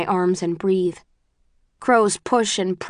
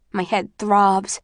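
ordinary delivery, nothing marked

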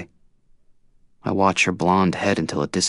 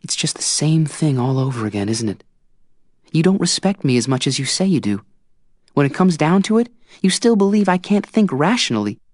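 put-on higher young woman's voice building to a louder peak; Tris, growing angry; 10 semitones higher, 4 dB louder than usual. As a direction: Tris losing patience with him. Narrator voices Tris, rising anger.

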